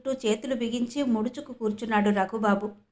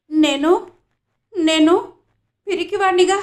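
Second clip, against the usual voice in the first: put-on a boy's halting, tearful voice, raised high and loud; hurt little boy; 7 semitones higher, 8 dB louder than usual. Raghubabu tells Parvati through tears he's called a coward, halting and tearful. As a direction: stammer it out, close to tears.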